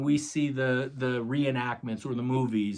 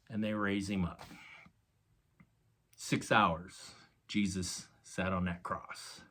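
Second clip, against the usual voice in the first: ordinary talk, nothing marked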